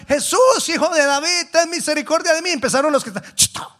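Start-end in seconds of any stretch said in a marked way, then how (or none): 0.1-2.5 high, loud beggar's cry for mercy; acted pleading shout. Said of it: "empezó a gritar". 3.3-3.7 short, sharp shushing commands; acted crowd telling someone off; mock hushing. Crowd hushing the shouting blind man.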